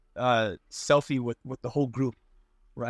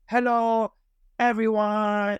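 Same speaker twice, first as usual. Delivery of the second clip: high, louder sing-song maid greeting; chirpy imitation of the cafe maid, pitched up; imitating the maid; 7 semitones higher, 3 dB louder, much slower than usual. Storyteller mimics the maid greeting customers, high and bright. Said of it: "It's exactly how she sounds".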